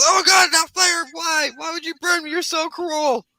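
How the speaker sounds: high-pitched, hammed-up screams of protest; screaming wizard. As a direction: scream as a burned, wounded victim. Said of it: "starts screaming".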